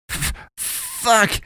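stammered terror. A narrator's reading. Terrified man stammers curse.

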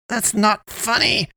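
high, drawn-out angry protest; indignant shout. A narrator's reading. Poe shouts at Damien for joking, indignant. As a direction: shout it high without much added volume. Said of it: "she shouted".

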